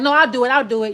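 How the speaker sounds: loud, pushy cut-in; insistent interruption. Acted. Someone cutting off the querent as they start talking, insistent.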